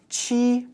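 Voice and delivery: "qi" held high and level; first tone. Shown as a demonstration. Teacher showing learners number seven, high first tone. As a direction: sustain "qi" on an even high pitch. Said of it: "first tone".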